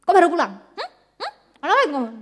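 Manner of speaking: loud suspicious scolding with whiny hums; nagging wife. Acted. Wife nagging her husband who came home late. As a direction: needle him like a suspicious wife.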